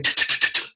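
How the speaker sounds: t, t, t, t as short crisp voiceless taps; repeated T. Shown as a demonstration. Showing the class that T can only be repeated. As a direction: tap out t, t, t, t separately. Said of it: "so you can say t".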